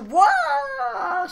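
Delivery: a high, loud shriek of disbelief; shocked cry. Acted. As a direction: yelp "What?" in total shock.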